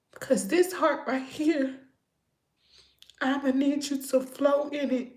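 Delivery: high, fast imploring plea; pleading prayer. Retold or imitated. She retells begging God for a new heart, high and imploring.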